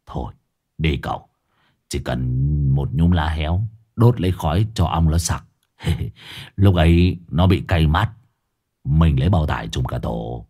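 gruff voice laying out the plan; low Hải Nghẹo. A narrator's reading. Hải Nghẹo tells the others how to smoke the hornets, low.

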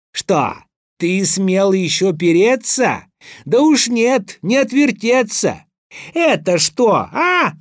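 high, triumphant cross-examining; outraged accusation. A narrator's reading. Tsar shows Ivan the feather he denied, outraged accusation. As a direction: pounce on the liar, voice climbing.

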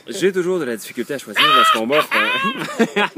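voix sensuelle qui part en fou rire. Acted. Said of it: "une petite voix, euh, sensuelle?". (voix sensuelle étouffée par le rire)

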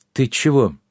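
ordinary delivery, nothing marked

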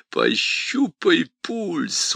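high, slow sneering demon voice. Acted. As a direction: smirk as you taunt. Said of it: "усмехнулся бес".

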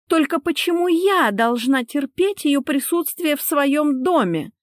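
high-pitched, aggrieved demand; indignant protest. Acted. Wife objects to guest in her home, high indignant protest. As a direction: demand an answer in a high, outraged voice.

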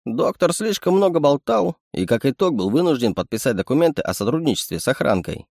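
a sly little animal sidekick; sly chittering raccoon. Acted. Raccoon explains.

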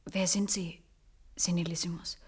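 whispered, hushed question; awed whisper. Acted. Nurse asks the old man his secret in a whisper. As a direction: breathe the name in awe.

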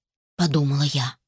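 usual speaking voice

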